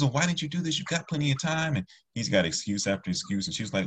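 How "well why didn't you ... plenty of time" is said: high-pitched mock scolding; upset mom. Wife questioning son about missed homework, upset scolding. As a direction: imitate an exasperated mom demanding an explanation. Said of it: "you don't wanna get mom upset".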